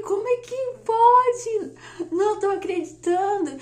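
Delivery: high, loud amazed exclamation; acted disbelief. Wife retelling her shock at the test, loud disbelief.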